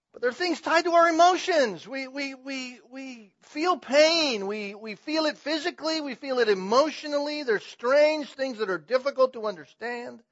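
high, loud pulpit surge; impassioned preaching. Real, not acted. Preacher telling the church how we feel pain, impassioned preaching.

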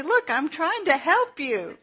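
a hint of a thick Southern accent, teasing; playful Southern drawl. Teaching the New York music minister to talk Southern, mock drawl. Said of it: "I'm trying to teach him to talk".

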